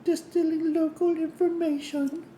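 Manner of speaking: high, sweet princess voice; lilting fairy tale princess; Princess Zelda voice. Pretend. Reading game text in character.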